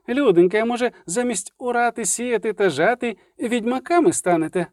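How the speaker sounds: high-pitched, exasperated bard; sarcastic bard. A narrator's reading. Dandelion ridiculing the peasants' vampire hunting, sarcastic. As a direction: scoff at them in a high voice.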